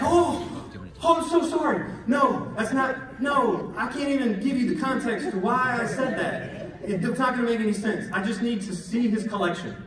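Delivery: high-pitched, panicky backpedaling; flustered apology. Retold or imitated. Son apologizing to mom for alarming her, flustered. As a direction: scramble to take it back.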